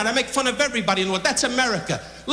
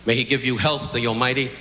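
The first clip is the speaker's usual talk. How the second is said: mock sincere toast; 6 semitones lower than usual. Comic toasts the president and first lady, mock solemn. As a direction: lower and solemn, a straight-faced blessing. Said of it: "To you, Missus Reagan".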